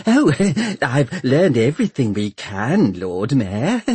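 high, giggly doctor; fluttery, lilting reply to a superior; twittering. A narrator's reading. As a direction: twitter it with little laughs. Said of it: "twitters the doctor".

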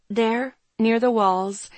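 ordinary delivery

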